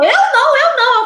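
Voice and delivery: loud, high protest; a caught student denies cheating to his teacher, loud and high; denying student. Retold or imitated. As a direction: insist you didn't do it. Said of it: "Aí ele falou".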